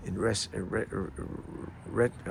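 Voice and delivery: stumbling over the first syllable; stuttering. Real, not acted. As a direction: caught in a stutter, repeating "re-". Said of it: "I'm stuttering like a".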